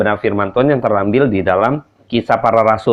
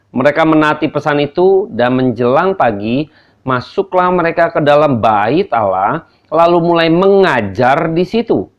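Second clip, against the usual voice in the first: raised, measured Bible reading; scripture reading; 6 semitones higher than usual. Acted. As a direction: read the passage out with weight. Reads scripture aloud.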